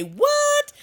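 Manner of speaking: high, loud squawk of disbelief; stunned what. Retold or imitated. Hearing fur trading is back, a loud high what. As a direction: shoot the word up in shock. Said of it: "I wanted to say".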